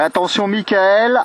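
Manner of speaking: ordinary talk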